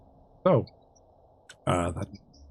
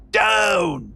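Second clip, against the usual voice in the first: high, loud, drawn-out warning yell; huge shout; 9 semitones higher, 6 dB louder than usual. Acted. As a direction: shout a warning across the ship. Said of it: "a huge shout saying".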